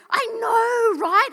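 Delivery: excited agreement. Retold or imitated. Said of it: "I'm like".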